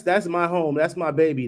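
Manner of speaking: normal talking voice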